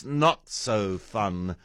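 ordinary talk

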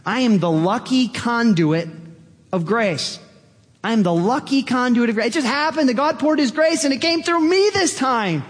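building to high-pitched delight; excited wonder. Acted. Acts out delighted lucky conduit.

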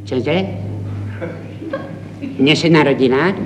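fussy father quizzing the maternity ward; one side of a phone call, chuckling and nitpicking; pedantic new father on the phone. Acted. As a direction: pedant repeating every detail, half amused. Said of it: "je pedant".

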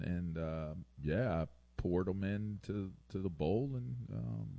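halting, mock-guilty stalling with long pauses; explaining out of trouble. Kid explaining himself to parents, hesitant and evasive. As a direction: drag out a feeble alibi, softly trailing into ums. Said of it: "That's how I sounded when I was trying to explain myself out of trouble".

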